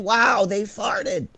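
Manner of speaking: very high, slow, airy gasp of wonder; mocking awe. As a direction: ham up dumb amazement. Mocking people gawking at royals.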